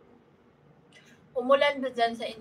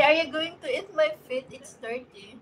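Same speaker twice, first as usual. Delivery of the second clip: normal talking voice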